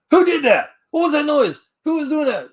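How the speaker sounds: high, loud demanding shout; band director yelling. Retold or imitated. Band director to noisy class, high loud shout. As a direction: bark it out like a startled band teacher.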